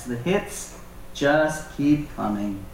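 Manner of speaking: ordinary talk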